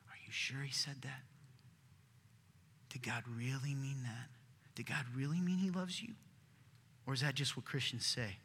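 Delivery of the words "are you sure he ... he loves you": a hint of a sly serpent, whispered; lightly voiced hissing tempter. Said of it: "What'd the serpent say?".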